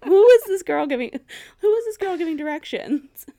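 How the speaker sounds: loud, high, outraged shout; exasperated shout at a vague boss; exasperated yell. Retold or imitated. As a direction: yell it in disbelief. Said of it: "I'm like".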